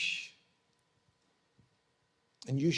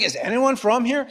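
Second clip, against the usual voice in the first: high-pitched comic exasperation; mock bafflement; 6 semitones higher than usual. Newcomer asking around Starbucks for locals, comically baffled.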